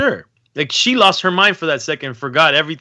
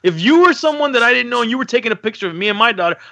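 raised, higher mock telling-off; retold scolding; 8 semitones higher than usual. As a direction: snap at mom as you retell it. Scolding mom in a retold scene.

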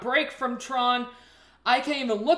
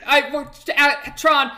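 usual speaking voice

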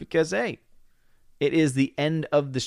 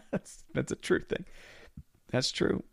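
quiet laugh; 12 dB quieter than usual. Real, not acted. Laughing agreement.

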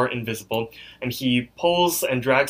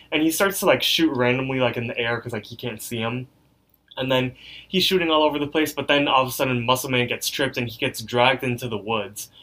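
usual speaking voice

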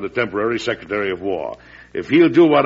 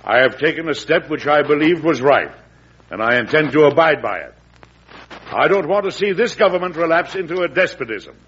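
defiant formal speech; 7 semitones higher than usual. Acted. President addressing the nation in a defiant, rising oration. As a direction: declaim it like a president defending his stand.

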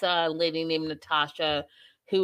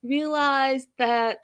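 mock Darcy voice; 7 semitones higher than usual. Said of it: "She's like".

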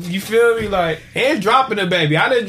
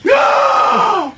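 loud, very high drawn-out "no"; acted scream; 5 dB louder than usual. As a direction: yell it out as if mid-fall. Acting out his cry while falling.

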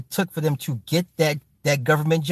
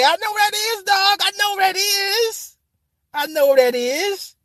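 squeaky high excitement. Real, not acted. Excited recognition of the road.

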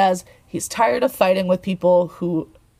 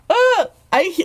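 giddy; 6 semitones higher than usual. Real, not acted. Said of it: "I literally can't sit still".

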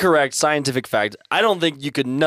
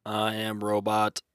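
toy robot voice; 4 semitones lower, 7 dB quieter, slower than usual. Pretend. Introducing selves as computer simulations.

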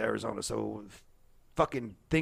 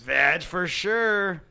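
joking drawl; 5 semitones higher, 3 dB louder, much slower than usual. Real, not acted. Joking rhyme on Sag.

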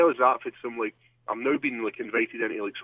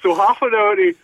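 high, louder, slowed; exasperated; 8 semitones higher, 7 dB louder than usual. Real, not acted. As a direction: push it up, exasperated. Scrubbing face red, retelling.

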